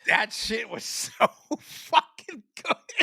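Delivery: slow, laughing through the words; real laugh while savoring it. Real, not acted. Recalling the delicious soda to his cohost, laughing.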